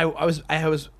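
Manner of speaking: ordinary delivery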